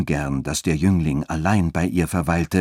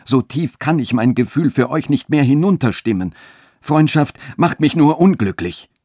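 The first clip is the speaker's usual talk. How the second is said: high, raised, impassioned outcry; utmost passion; 9 semitones higher, 5 dB louder than usual. A narrator's reading. Friedrich refuses mere friendship from Katharina, in utmost passion. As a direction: protest ardently, pitch driven up. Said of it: "rief Friedrich jetzt in höchster Leidenschaft".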